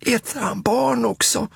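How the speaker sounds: Småtting gasping. Acted. Småtting worried the monster eats children, anxious small child. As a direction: ask small and breathless. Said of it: "Flämtar Småtting".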